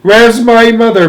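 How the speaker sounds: high, very loud demand; bawling. A narrator's reading. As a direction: bawl it out in distress. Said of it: "bawled Missus Bucket".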